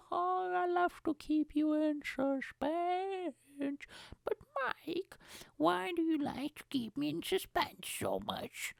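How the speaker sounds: slow, drawn-out put-on whispery voice; hammed-up cheeky villain act; silly teasing voice. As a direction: tease with exaggerated, theatrical slowness. Silly teasing aside to himself.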